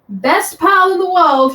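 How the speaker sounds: loud, high and slow. Acted. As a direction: call it out loudly and slowly. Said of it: "he says loudly".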